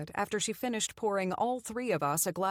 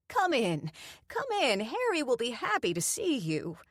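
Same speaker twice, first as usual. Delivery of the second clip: put-on eager welcome from a kindly old woman; eager old lady; 6 semitones higher than usual. A narrator's reading. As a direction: usher her in like a doting grandma. Doreen ushers her inside.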